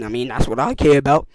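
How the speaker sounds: ordinary talk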